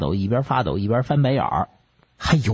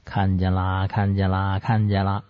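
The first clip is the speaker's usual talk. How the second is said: monotone incantation, slightly low; trance-like muttering; 3 semitones lower than usual. Acted. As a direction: intone it like a medium in a trance. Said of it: "口中还念念有词".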